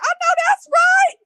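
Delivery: high loud hammed-up sob of approval; hammed-up crying shout; mock wailing cry. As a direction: wail it in mock tears, cheering him on. Mocking his crying.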